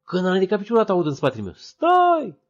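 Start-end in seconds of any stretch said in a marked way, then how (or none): 1.5-2.4 a sudden high, loud yell; loud warning cry from behind; shouted stop. Retold or imitated. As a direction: stop him from stepping into the water. Stranger calls out at the river.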